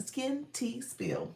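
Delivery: "Skin Tea Spill" slowly, word by word; careful, slowed-down "Skin Tea Spill". Real, not acted. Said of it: "I always have to say it slow".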